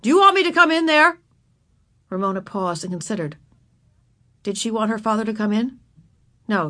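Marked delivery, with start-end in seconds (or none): 0.0-1.2 louder, raised father's threat; stern rising challenge, high and loud; warning. A narrator's reading. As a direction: warn the child from the next room. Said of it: "voice held a warning note".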